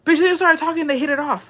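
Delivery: high, loud squeal of disbelief; gossiping in squeaky astonishment; amazed exclamation. Real, not acted. Amazed that the two men got along.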